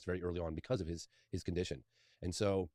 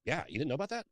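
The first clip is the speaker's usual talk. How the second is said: mock surprise, pitched much higher; surprised question; 8 semitones higher than usual. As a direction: act amazed he's never heard of it. Boss retold, surprised he didn't know.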